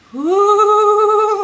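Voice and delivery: long, high, loud woo; excited whoop. Real, not acted. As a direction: let out a sustained high whoop. Whoop while saying she is vibrating.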